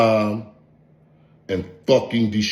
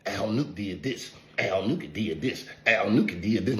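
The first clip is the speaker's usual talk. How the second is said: soft, slow mock complaint; a hint of a tattling crybaby; mocking whine; 10 dB quieter than usual. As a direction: mimic a victim whining on the phone, quietly. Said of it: "get on the phone with and say".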